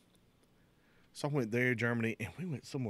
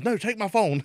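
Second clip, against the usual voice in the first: high, loud retold insistence; mugging victim; 11 semitones higher, 10 dB louder than usual. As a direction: press it on him, louder and higher. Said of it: "He says".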